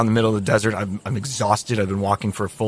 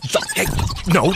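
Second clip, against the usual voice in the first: ordinary talk, nothing marked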